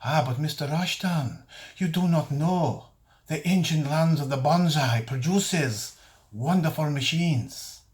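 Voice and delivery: put-on slow, stretched caricature Eastern accent; grand sales pitch in a fake Eastern accent; mock Eastern accent. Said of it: "Quite a good accent".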